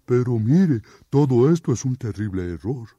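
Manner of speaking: oso suplicante y fuerte; suplicando. Acted. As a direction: ruega que le crean. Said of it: "El oso le suplicó". (oso suplicante)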